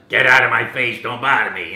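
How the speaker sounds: loud, gruff Brooklyn tough guy; a brash Brooklyn Italian shout. Retold or imitated. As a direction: bark it like a Brooklyn plumber. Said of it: "Italian plumber from Brooklyn".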